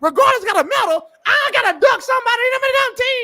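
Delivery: fast, high scornful ranting; host mocking the idea he'd be ducking; incredulous rant. Real, not acted. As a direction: rattle it off in disgusted disbelief.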